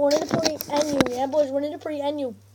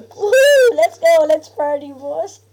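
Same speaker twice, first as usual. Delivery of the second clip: whooping cheer; 8 dB louder, slower than usual. Real, not acted. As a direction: celebrate wildly, all out. Cheering in game.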